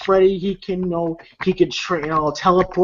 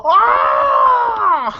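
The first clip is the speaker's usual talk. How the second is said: high, loud hammed-up scream; mock scream; 5 dB louder than usual. Freddy hit by the explosion, hammed-up scream. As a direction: belt out a cartoonish scream of pain. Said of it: "Freddy goes".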